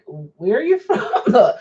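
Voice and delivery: laughing through the question; chuckling, rising question; amused disbelief. Retold or imitated. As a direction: ask it incredulously through giggles. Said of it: "I'm like".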